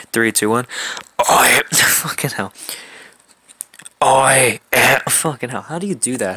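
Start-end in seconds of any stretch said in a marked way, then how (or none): 4.0-5.0 loud, forced growl; failed attempt at a superhero growl; Batman. Trying to introduce the villain, Batman voice. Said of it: "How do you do the Batman voice?".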